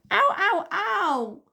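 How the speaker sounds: high pained yelp; sharp high ow of pain. A narrator's reading. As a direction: cry out at the pinch, high and loud. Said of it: "yelled Susan".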